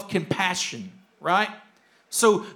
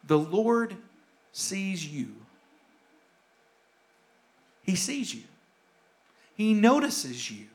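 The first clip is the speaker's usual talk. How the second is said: intimate reassurance; 7 semitones lower, 6 dB quieter than usual. Acted. Pastor assuring a hurting listener the Lord sees them, soft and tender. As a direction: speak right to one hurting listener.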